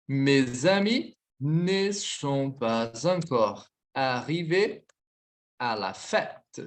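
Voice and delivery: very slow, paused between phrases; measured dictation with long pauses. Shown as a demonstration. Slow repeat of dictated sentence.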